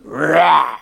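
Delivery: a tiny dog's sudden growl; mock dog growl. The little dog turning on Esther at his door, mock growl. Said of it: "He growled at Esther".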